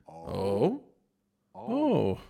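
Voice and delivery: long rising oh, then a falling one; soft drawn-out oh as it sinks in; dawning realisation. Acted. Disciples seeing who broke the bread, dawning realisation. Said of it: "denken sie".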